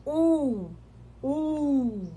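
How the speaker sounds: "u" held long and lifted. Shown as a demonstration. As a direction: stretch "u" out with a lift. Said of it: "Dá essa elevada".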